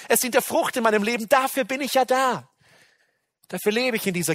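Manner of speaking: loud, high, full-force sermon climax; fervent preaching. Acted. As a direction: preach it out with rising fervor.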